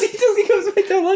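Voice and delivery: very high laughing parody; squeaky mock voice. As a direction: pitch it way up to mock him. Mocking the hero, laughing.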